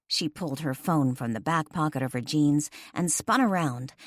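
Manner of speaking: ordinary delivery, nothing marked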